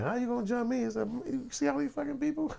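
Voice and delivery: very high, squealed retelling; mock incredulous plea. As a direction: squeal it in exaggerated disbelief. Acting out incredulous plea to attackers.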